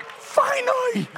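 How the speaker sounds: long, drawn-out high cry of relief; high-pitched, slow exclamation; exclaimed relief. Retold or imitated. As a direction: stretch it out high, as if long awaited. Preacher voices his relieved reaction.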